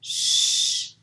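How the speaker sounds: "ch" as a long, loud hush; strong unvoiced hushing "ch"; isolated ch sound. Shown as a demonstration. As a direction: push out a sustained "ch", shhh. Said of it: "C H fait le son ch".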